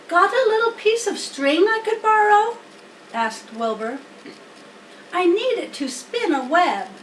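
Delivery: a hopeful piglet asking a favor; eager pig. Acted. Wilbur asking Templeton for string, high and eager.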